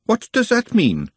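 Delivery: sharp, high-pitched demand. A narrator's reading. Glenda on the lookout for dangers, sharp question. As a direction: pounce on it with suspicion. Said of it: "said Glenda sharply".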